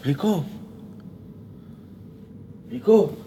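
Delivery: rising calls, building from questioning to shouted; searching call. Acted. Calling out for missing friend. (appel inquiet qui monte jusqu'au cri)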